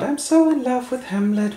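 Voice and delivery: put-on high dreamy teen voice; lovesick girl. Ophelia moons about Hamlet in a high lovesick voice. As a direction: swoon like a besotted teenager.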